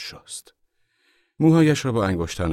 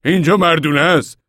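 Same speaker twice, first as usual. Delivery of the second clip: loud, high, bad-tempered retort; cross and sour; 11 semitones higher, 10 dB louder than usual. A narrator's reading. Old man scolds the mother in the men's room, crossly. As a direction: scold the intruder with raised voice. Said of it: "با اوقات تلخی و در حالی که رو ترش کرده بود گفت".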